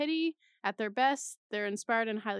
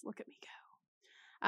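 whisper; 9 dB quieter, much slower than usual. Real, not acted. Self-mocking aside while reading.

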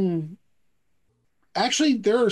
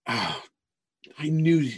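long sighed oh, then resigned grumble; exasperated mom; 4 semitones lower, slower than usual. Acted. Mom over her fainted son, exasperated and resigned. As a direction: sigh it out, fed up with the boy.

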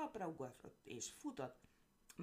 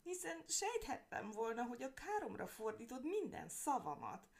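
loud wailing lament; 10 semitones higher, 4 dB louder than usual. Acted. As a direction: wail it like a hammed-up victim. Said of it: "nagy jajveszékelésbe fogott".

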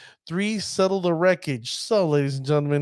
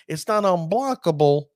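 ordinary delivery

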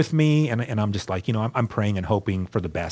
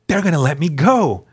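louder, much higher, slow and eager; convinced this is the big day; eager hope; 6 semitones higher, 4 dB louder, slower than usual. Retold or imitated. Inmate sure the judge will free him, eager. Retold hopeful inner thoughts.

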